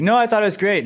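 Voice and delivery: high, loud insincere reassurance; eager false protest. Acted. Employee lying to the boss about an idea, eager protest. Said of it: "And you're like".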